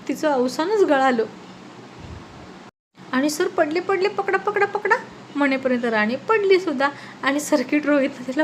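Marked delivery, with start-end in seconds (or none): 3.3-4.9 rising higher and higher in panic, calling out to be caught; a frightened shout as she falls; panicked cry for help. Acted. Rani falling off the stool.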